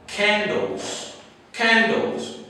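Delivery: "Candles" with a strong aspirated K; hard K sound. Shown as a demonstration. Teacher modelling the word candles for learners. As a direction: punch the K of "Candles". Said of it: "I want you to listen carefully".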